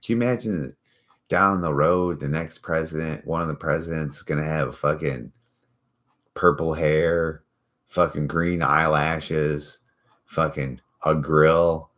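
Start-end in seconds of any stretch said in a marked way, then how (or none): 6.3-11.9 slow list. Real, not acted. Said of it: "slowed listing".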